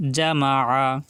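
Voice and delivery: জামাআ with the আইন pressed from mid-throat; Arabic pronunciation demo. Shown as a demonstration. Teacher repeats জামাআ for pupils, আইন pressed in the throat. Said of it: "আইন কিন্তু গলার ভিতরে যাবে".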